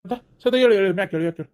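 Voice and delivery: sobbing, pleading to wake; crying. Acted. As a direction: beg her to get up through tears. Husband cries over fainted wife.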